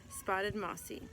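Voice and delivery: ordinary delivery, nothing marked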